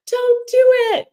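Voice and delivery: very high, loud cry of don't; shouted warning. Pretend. Acorns in the tree shouting at the jumper, high yell. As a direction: shriek it up high like tiny acorns.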